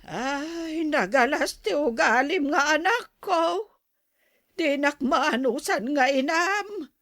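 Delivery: indignant scolding. Acted. Mother berates daughter as ungrateful.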